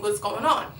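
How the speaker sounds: ordinary talk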